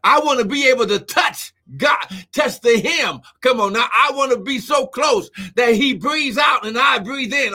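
full-force preacher, voice pushed on key words; preaching. Real, not acted. Preacher urging listeners to get close to God, preaching. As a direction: drive the exhortation home.

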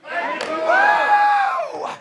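high, loud, jubilant shout; lottery winner joy. Real, not acted. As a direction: shout it like you won the lottery. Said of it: "like you just won the lottery".

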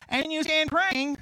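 preaching scripture. Acted. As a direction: proclaim the verse slowly and loud.

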